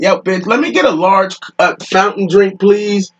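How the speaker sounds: put-on high, fed-up demand to the counter girl; retelling an irritated repeat to the cashier; exasperated retold order. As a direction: snap the order out, annoyed. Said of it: "I'm like".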